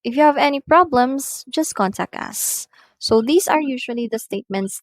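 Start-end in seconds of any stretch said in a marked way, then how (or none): 0.0-2.7 high rising condition, falling close; rise-fall intonation. Shown as a demonstration. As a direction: rise on "If you have any problems", fall on "just contact us". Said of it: "first statement requires a rising intonation".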